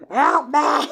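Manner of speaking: high, loud hammed-up wail for help; mock cry for help. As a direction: pretend to be overwhelmed and yelp for rescue. Mock-panicking American listener.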